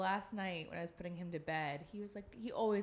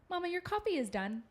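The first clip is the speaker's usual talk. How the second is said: put-on high, louder kid voice; little boy voice; 11 semitones higher, 6 dB louder than usual. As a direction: announce it like a proud toddler. Son announcing the microwave.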